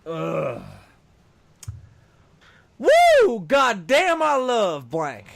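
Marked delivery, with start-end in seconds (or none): 2.8-5.3 put-on whooping drunk Southern guy, loud and high; southern drunk accent. Card reader hams a drunk Southern guy: loud Southern holler. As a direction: holler it like a drunk Southern good ol' boy. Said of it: "I will read that with a southern accent".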